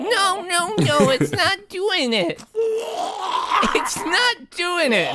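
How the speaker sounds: very high whiny creature voice, half laughing; Twiggly's squeaky voice. Acted. Twiggly insisting the sword fails, high whiny squeak. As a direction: squeak out the protest. Twiggly keeps complaining in character.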